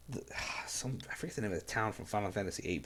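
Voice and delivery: whispered, hurried aside; fast whispered aside. Real, not acted. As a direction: drop to a quick whisper to yourself. Muttering aside about a game town.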